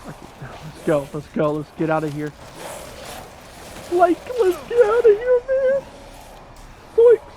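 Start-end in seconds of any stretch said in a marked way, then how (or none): 3.9-7.2 bad high Shaggy voice, squeaking up; a failed Shaggy impression, pitched way up; badly done high cartoon stoner voice. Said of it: "I can't do the Shaggy voice right".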